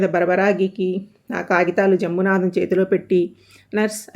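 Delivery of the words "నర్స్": usual speaking voice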